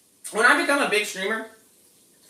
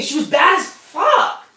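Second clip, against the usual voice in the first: drawn-out gush; 8 semitones higher, much slower than usual. Real, not acted. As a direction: stretch it out in awe. Gushing over a beautiful woman.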